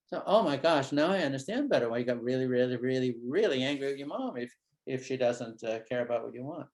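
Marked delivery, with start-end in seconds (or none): none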